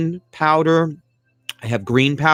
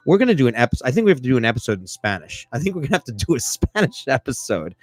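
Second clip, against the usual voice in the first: ordinary delivery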